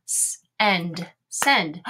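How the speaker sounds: S-, end, then blended send; teacher blending send from its sounds; sounding out. Shown as a demonstration. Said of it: "gonna say the S sound".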